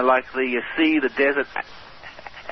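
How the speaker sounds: usual speaking voice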